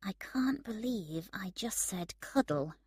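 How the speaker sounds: hushed, breathy whisper. A narrator's reading. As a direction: whisper it, a little embarrassed. Embarrassed afterthought in bed.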